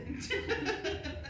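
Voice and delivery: soft airy laugh; stifled giggling; breathy high giggle. Real, not acted. Reader laughs.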